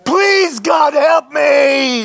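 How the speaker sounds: high, slow, loud desperate wail; crying out to God. Shown as a demonstration. Preacher showing the congregation how to plead with God. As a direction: cry out broken for help, drawn out. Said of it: "That's how we do it".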